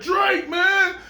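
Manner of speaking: high, fast fanboy gush; starstruck fan. Pretend. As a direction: gush like meeting your idol. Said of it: "you be like".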